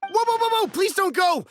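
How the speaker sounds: high, loud, frantic stop-her cry; flustered merchant begging; panicked pleading. Acted. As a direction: blurt it out in a rush of panic to keep her from leaving. Buyer panics as seller threatens leaving.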